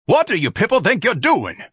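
high, loud, indignant demand; outraged shout. A narrator's reading. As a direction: yell at the crowd in outrage. Ignatius bellows at his workers.

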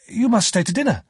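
high-pitched urging; insistent plea. A narrator's reading. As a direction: press the guest to stay, voice up high. Pip insists Joe stay.

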